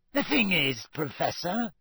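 pleading, put-upon caretaker; plaintive. A narrator's reading. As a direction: appeal plaintively to a superior. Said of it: "said Filch plaintively".

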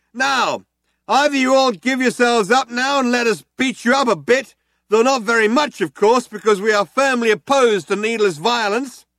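loud, high, earnest ultimatum; reasonable cop with a threat. A narrator's reading. Cop giving the ultimatum over the hailer, loud and high. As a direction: offer the choice politely.

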